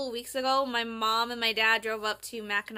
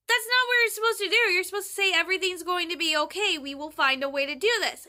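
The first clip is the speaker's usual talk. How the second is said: exasperated protest; 6 semitones higher, 4 dB louder, faster than usual. Retold or imitated. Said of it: "And I'm like".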